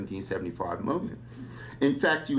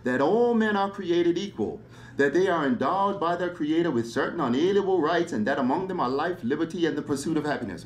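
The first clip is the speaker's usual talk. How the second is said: lofty recitation; 6 semitones higher than usual. Acted. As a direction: proclaim it like a founding document. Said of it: "very lofty verses".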